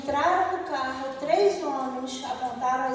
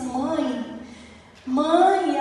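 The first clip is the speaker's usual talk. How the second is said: crying; 4 semitones higher, 6 dB louder than usual. Retold or imitated. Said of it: "me ligar chorando".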